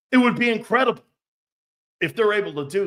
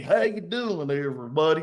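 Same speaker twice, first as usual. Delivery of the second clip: fake Cajun accent; 2 semitones lower than usual. Host mocking coach from New Hampshire, fake Cajun accent. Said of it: "phony-ass accents".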